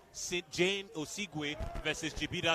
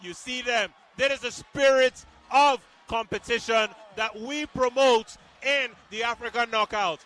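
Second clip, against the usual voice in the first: higher, louder hyped play-by-play; excited commentary; 7 semitones higher, 7 dB louder than usual. Real, not acted. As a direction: celebrate the knockout on air.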